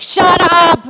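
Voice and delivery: loud, snapping shout of a mean counselor; imitating a yell. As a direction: bark it like a harsh counselor silencing kids. Mean counselor Trixie yelling.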